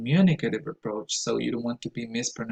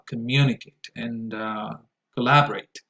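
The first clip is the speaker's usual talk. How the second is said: communicate and collaborate stressed on MU and LAB; second-syllable stress. Shown as a demonstration. Showing learners the right stress, communicate and collaborate second-syllable stressed. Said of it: "the right stress is".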